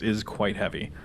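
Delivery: ordinary talk, nothing marked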